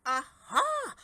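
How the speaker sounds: high, loud burst of discovery; excited aha. A narrator's reading. Redbeard struck by a sudden idea, excited cry. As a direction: shout out the idea.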